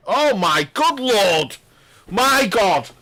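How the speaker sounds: slow, high, loud gasp of disbelief; astonished outburst. Real, not acted. Commentator stunned by a fluke, loud disbelieving cry. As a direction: blurt it out stunned at the shot.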